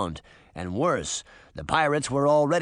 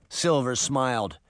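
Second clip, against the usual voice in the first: plain reading voice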